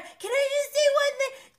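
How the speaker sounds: high, far put-on pleading voice; whiny interruption. Recapper mimics Schwartz begging to speak, high and pleading. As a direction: squeak it out like a nervous pest.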